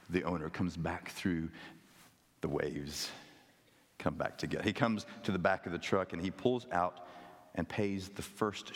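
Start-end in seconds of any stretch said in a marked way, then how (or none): none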